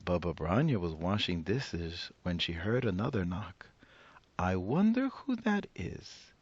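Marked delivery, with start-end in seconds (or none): 4.4-6.3 put-on high, bright old granny greeting a guest; old woman voice. Old woman welcoming the rabbi at her door, high and cheery. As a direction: play the cheerful old grandmother.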